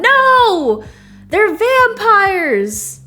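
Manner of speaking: high panicked yell; dramatic shout. Acted. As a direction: scream it in horror. Dramatic game line shout.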